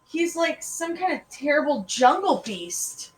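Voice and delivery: put-on high, slow child's complaint; indignant disgust. A narrator's reading. Allison glaring balefully at the dog, indignant and dramatic. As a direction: draw it out with hammed-up horror. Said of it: "glaring balefully at poor old boy".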